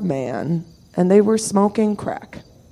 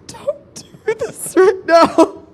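pleading; 12 semitones higher, 5 dB louder, slower than usual. Retold or imitated. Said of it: "I'm like".

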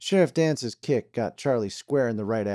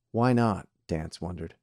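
ordinary delivery, nothing marked

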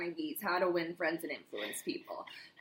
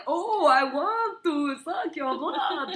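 slow, higher, louder mock vampire; Count Dracula voice; 7 semitones higher, 5 dB louder, much slower than usual. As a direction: ham up mom's Dracula accent. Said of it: "comes out as Count Dracula".

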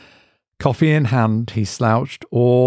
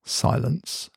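usual speaking voice

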